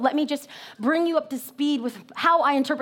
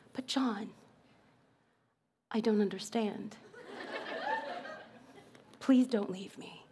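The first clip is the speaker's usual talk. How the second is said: very soft, low, pleading soap heroine; forlorn jilted lover; 4 semitones lower, 13 dB quieter than usual. Acted. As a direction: plead almost under your breath, with long hurt pauses. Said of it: "looks at her forlorn lover who's just broken up with her".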